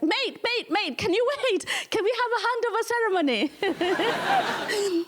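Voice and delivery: high-pitched, wheedling mock plea; pleading British government. British government begging Kenyans for a handover ceremony, mock pleading. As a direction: plead like a loser calling after the winner. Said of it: "and they're like".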